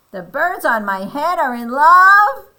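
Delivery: high, loud disbelieving question; incredulous. Acted. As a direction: exclaim it as a shocked Elephant. Elephant incredulous at news.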